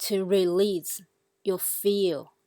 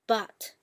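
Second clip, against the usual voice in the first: "but" as a falling fourth tone. Shown as a demonstration. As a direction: say "but" with a firm fall. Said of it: "all the four tones".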